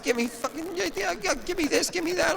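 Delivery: high, eager give me this give me that; greedy excitement. Retold or imitated. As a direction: grab everything in sight like a kid. Greedy grabbing in candy shop.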